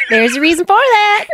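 high, loud comeback; loud high-pitched jab; shouted retort. Acted. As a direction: call it after him, laughing. Retort called after departing character.

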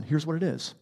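ordinary talk, nothing marked

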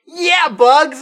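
high, loud fake cheer; mock excited kid. Kid actor cheering at a cartoon rabbit, hammed-up. Kids acting cringe with Bugs.

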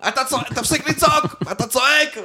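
high-pitched mock of his mother scolding; imitating mom yelling. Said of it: "אמא שלי הייתה אומרת לי". (אמא נוזפת בבן עם אוזניות, חיקוי צעקה בקול גבוה)

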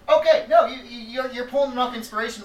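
high, loud burst of exasperation; exasperated outburst. Real, not acted. Friend pleading with the group about the movie, loud exasperated burst. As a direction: throw up your hands, half-shouting.

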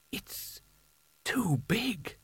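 very soft, hushed aside; under his breath. A narrator's reading. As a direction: mutter it quietly to yourself. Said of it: "said Spelter under his breath".

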